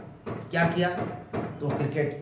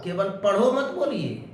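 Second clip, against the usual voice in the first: usual speaking voice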